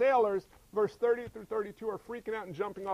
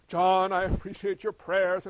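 lightly voiced angel, loud and slow; a hint of a stately messenger, measured and loud; mock angelic messenger; 8 dB louder than usual. Preacher imagining an angel visiting John, lightly mocked slow proclamation.

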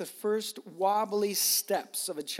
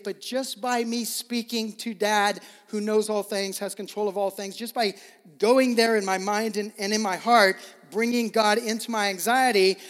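loud, rising, impassioned preaching; preacher building to a loud peak; preaching at full force; 5 semitones higher, 8 dB louder than usual. Real, not acted. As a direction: build the climax and let it ring out.

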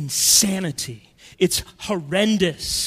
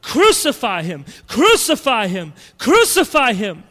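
louder each time, mob chant; growing mob shout; 3 semitones higher, 6 dB louder than usual. Retold or imitated. Preacher voicing the crowd, building to a shout. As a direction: build the cry with each repeat. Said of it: "their cries get louder and louder and louder".

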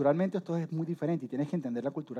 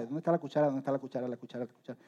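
fading, repetitive mumble; muttered searching; 3 semitones lower, 6 dB quieter, faster than usual. Acted. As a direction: mutter to yourself while hunting for something. Acts out fruitless kitchen search.

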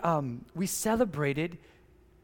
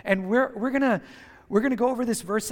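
usual speaking voice

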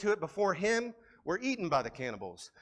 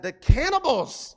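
higher, louder alarmed cry, acted out; a mock panicked friend, pitched up; alarmed warning; 9 semitones higher, 5 dB louder than usual. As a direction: warn him in horror that they'll eat him. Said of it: "one person said".

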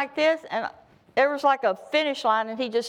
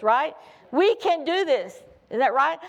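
ordinary talk

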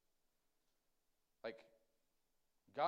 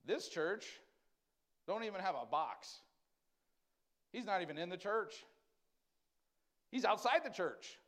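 rising, louder preacher's build; fired-up sermon delivery; preaching; 5 semitones higher, 5 dB louder than usual. Acted. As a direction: drive the point home from the pulpit. Preaching builds about absent God.

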